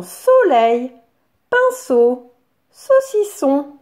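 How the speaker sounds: "soleil, pinceau, saucisson" slow and emphatic, syllables apart. Shown as a demonstration. Teacher having children hunt for a syllable, "soleil, pinceau, saucisson" syllabified. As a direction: punch out each beat of "soleil, pinceau, saucisson". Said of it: "On va chercher la syllabe seau".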